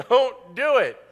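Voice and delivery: loud, high commanding voice; God's command. Acted. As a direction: boom it out as a divine order. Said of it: "It's as simple as God said".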